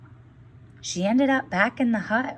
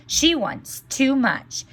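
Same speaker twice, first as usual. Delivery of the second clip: slow, higher, firm refusal; stern fish; 6 semitones higher, 3 dB louder, slower than usual. Acted. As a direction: lay down the law as the magic fish. Fish refuses the greedy wish.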